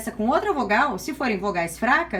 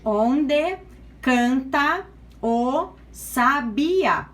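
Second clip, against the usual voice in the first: "Onde canta o Sabia" very slowly, syllable by syllable, with pauses; teacher dividing "Onde canta o Sabia" slowly for scansion; split into syllables; much slower than usual. Shown as a demonstration. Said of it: "como separa onde?".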